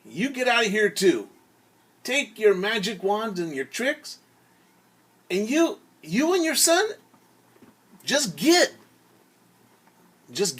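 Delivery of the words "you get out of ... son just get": much higher and louder, getting shriller toward the end; angry townspeople. Acted. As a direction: chase him out of town with rising outrage. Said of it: "People said to the father".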